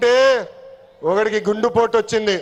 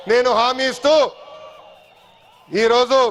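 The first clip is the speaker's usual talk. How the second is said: rally shout. Real, not acted. Rally pledge climax.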